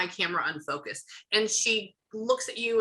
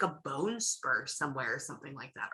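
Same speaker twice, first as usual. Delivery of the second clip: ordinary delivery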